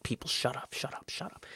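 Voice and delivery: soft, then whispered shut up, shut up; frantic whisper. Retold or imitated. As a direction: hiss it to block out the noise. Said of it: "He's like".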